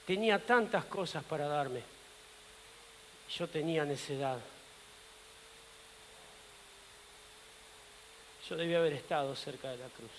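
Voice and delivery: low, soft self-reproach; quiet regret. Acted. Peter laments his own foolishness, quietly regretful. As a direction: sink into a hushed, regretful confession.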